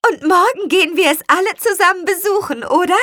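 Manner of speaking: high, fast and loud; eager. A narrator's reading. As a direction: rush it out in delight. Lea freut sich. (aufgeregt, hoch und schnell)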